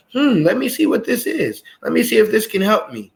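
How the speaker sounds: high-pitched, interested pondering; curious open mind. Acted. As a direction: think aloud as someone willing to try. Voicing an open-minded person's thought.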